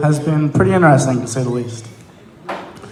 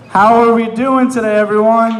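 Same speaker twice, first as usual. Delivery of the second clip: higher, shouted crowd greeting; louder, more excited; 6 semitones higher than usual. Shown as a demonstration. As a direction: pump up the audience. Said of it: "Let's try that one more time".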